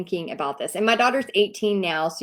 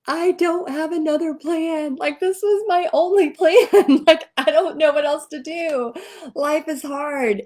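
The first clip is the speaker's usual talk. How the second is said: high, laughing tipsy wail; tipsy lament; 7 semitones higher, 3 dB louder than usual. Retold or imitated. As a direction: whine it out a couple of drinks in, half laughing.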